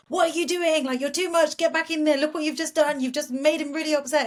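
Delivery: high, loud berating inner voice; self-scolding. Acted. Coach acts out beating yourself up over a slip, scolding. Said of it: "beating your little girl up and thinking".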